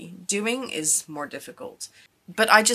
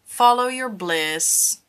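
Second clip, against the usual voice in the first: slow, louder motto; odd emphatic delivery; 2 semitones higher, 5 dB louder, slower than usual. Real, not acted. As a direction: draw it out like a grand slogan. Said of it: "why I said it like that".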